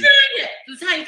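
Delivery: excited greeting. Real, not acted. Host excitedly calling the guest.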